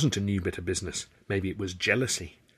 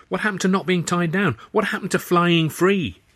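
agitated protest; 9 semitones higher, 6 dB louder than usual. Acted. Arthur protests to Tilly about the offer, high and agitated. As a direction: pitch up high, pressing her with both questions.

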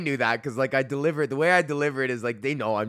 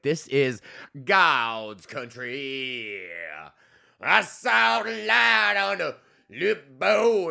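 mock awe; much slower than usual. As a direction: gape at the mountains like a revelation. Awed by Alberta scenery.

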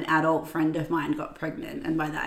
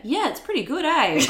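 higher-pitched mock Kiwi with a louder "eh?"; playful exaggerated Kiwi, pitched up; Kiwi slang; 9 semitones higher, 5 dB louder than usual. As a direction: send up your own Kiwi habit, landing loudly on the eh. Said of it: "like the worst Kiwi slang as well".

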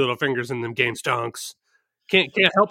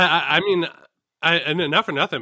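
usual speaking voice

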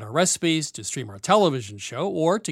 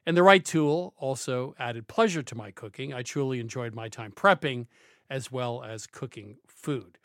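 smooth, measured commercial read; 5 dB quieter than usual. Acted. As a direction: read it like a radio sponsor spot. Host reads a car ad.

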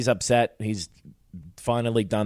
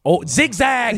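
high, loud playful cry; excited mock call; 13 semitones higher, 6 dB louder, slower than usual. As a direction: shout it like advice to someone fleeing. Excited call while joking about escaping.

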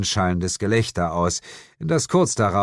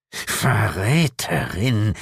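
slow, drawn-out devil's hiss; hissed accusation; much slower than usual. A narrator's reading. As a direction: hiss it slowly with menace. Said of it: "zischte Asmodes". (langsam und drohend gezischt)

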